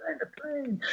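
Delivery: high but soft "the plane, the plane"; hushed high mock catchphrase; echoed catchphrase. Echoing the Fantasy Island catchphrase.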